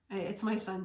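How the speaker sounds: ordinary delivery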